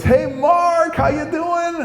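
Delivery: very high, chirpy greeting voice; hammed-up squeaky hail to a pal; Rich. TV station regular greets deli worker, high chirpy voice. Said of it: "this one guy named Rich".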